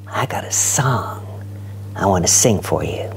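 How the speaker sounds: hushed confiding whisper. Acted. Poet confiding to the audience in a whisper. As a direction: lean in and whisper it to the room. Confiding line inside poem.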